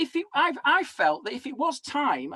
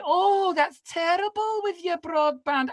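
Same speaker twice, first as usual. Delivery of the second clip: lightly voiced sweet elderly helpline lady, slow; a hint of a kindly old lady; 6 semitones higher, slower than usual. Comic imitates the sweet elderly broadband helpline lady. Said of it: "she was so sweet".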